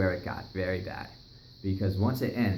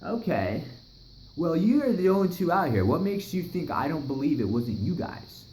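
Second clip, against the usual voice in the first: skeptical neighbor; 9 semitones higher, 3 dB louder than usual. Retold or imitated. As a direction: repeat their excuse like you don't buy it. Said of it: "he's all like".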